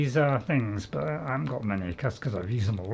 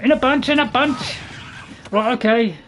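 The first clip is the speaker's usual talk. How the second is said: excited; 9 semitones higher, 6 dB louder than usual. Real, not acted. Enemies swarming him in combat.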